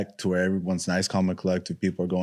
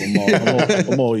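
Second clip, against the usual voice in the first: excited; 8 semitones higher, 6 dB louder than usual. Retold or imitated. Worked up retelling the guard.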